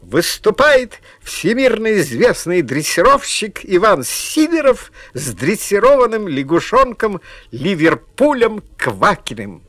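loud, high circus announcement; booming circus ringmaster. Acted. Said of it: "А потом объявили".

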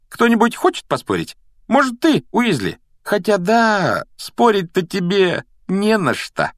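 high, needling challenge with stretched words; mocking taunt. A narrator's reading. Malfoy dares penniless Ron to bet, mocking. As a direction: goad him, drawing out the last jab.

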